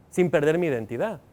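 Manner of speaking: ordinary delivery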